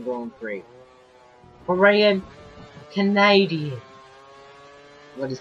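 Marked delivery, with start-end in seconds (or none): 1.7-3.8 loud, high outburst at own slip; blurted, raised-voice correction; exclaimed self-correction. Real, not acted. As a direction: call out the correction, pitched up. Catches own wrong race name.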